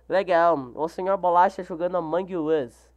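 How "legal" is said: ordinary delivery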